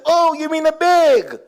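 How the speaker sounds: high, slow twangy exclamation; mock twang. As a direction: drawl the bag American. Store lady finally understands.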